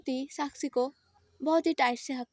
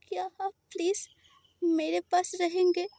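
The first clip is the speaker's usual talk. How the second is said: hesitant; 5 semitones higher than usual. Acted. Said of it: "हिचकिचाते हुए बोलता है".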